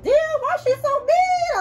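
shocked caller. Retold or imitated. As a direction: play the stunned person on speakerphone. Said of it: "They're like".

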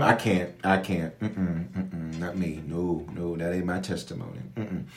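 murmured under the breath; soft, low-voiced personal aside; muttered mm-mm, not me; hushed aside. Real, not acted. Quiet aside refusing the gym.

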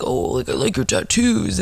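mock mumbled compliment; imitated mumbler. Woman mimicking a stranger who likes her tattoos, mock mumbling. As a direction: mimic a guy mumbling his words. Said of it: "One thing I hate is a mumbler".

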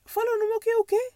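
scandalised, as if misheard; shocked inner reaction. Retold or imitated. Hearing herself called a wife, scandalised inner disbelief. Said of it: "Eu, na minha cabeça".

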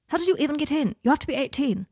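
high-pitched, incredulous demand; indignant scolding. Acted. Guardian questioning an underage girl about a club, indignant.

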